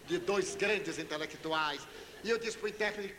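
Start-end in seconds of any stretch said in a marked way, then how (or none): none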